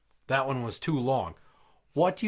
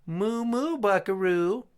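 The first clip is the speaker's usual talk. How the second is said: high, flirty put-on cowboy voice; hammed-up high-pitched come-on; pink cowboy; 8 semitones higher, 3 dB louder, slower than usual. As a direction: coo it high like a dainty little cowboy. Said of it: "the guy in the pink cowboy outfit goes".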